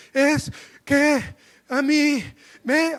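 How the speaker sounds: high, loud words broken by gasps; one syllable per sobbing breath, hammed up; mock sobbing, syllable-sigh. Preacher showing how he cried as a boy, mock gasping sobs. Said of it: "sílaba, suspiro, sílaba, suspiro".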